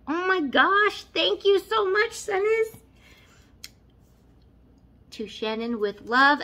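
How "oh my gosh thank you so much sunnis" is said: high gushing thank you; excited thanks. Real, not acted. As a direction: gush your thanks up high. Thanking giveaway sender.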